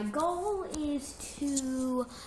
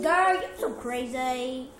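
louder, slow sassy scoff; 3 semitones higher, 10 dB louder, slower than usual. Acted. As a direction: drag it out with sass. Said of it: "I didn't really talk like that".

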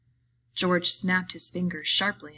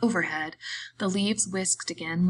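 usual speaking voice

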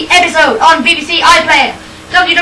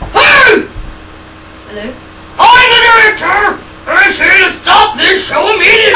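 shouting; 3 semitones higher than usual. Acted. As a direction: storm in and yell. Director bursts in shouting.

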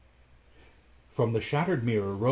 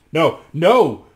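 loud, raised refusal; urgent cry; 5 semitones higher, 13 dB louder than usual. A narrator's reading. Rasmus keeps Anne from the mirror, crying out loudly. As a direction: cry out to stop her. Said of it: "cried Rasmus".

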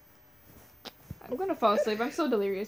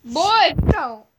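put-on higher, loud sharp "Boy"; mock mom shout; 3 semitones higher, 10 dB louder than usual. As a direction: scold the boy like an angry mom. Pretend mom scolding child.

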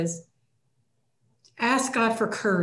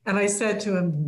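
normal talking voice

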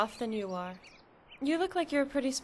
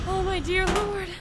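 horrified gasp; 6 semitones higher, 5 dB louder than usual. Acted. Mother sees the hideout.

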